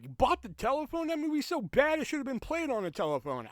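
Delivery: high sneering mock voice; mock commenter. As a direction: put on a high, snarky voice to quote them. Mocking commenters quoted.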